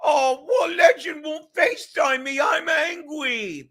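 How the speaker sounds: high, crying, shouting whine; hammed-up crybaby Kevin wail; mock whiny Kevin. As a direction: wail it like a big baby begging for attention. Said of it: "can I do my favorite Kevin thing?".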